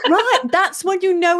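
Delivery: a burst of laughing agreement. Real, not acted. Host laughs along with Laurie about nearly crying.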